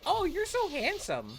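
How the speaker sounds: high, slow, swooning fan voice; mock flirty chatter. Viewer flattering the streamer, high and slow swooning. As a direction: coo it like a smitten fan. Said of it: "someone came into my chat and was like".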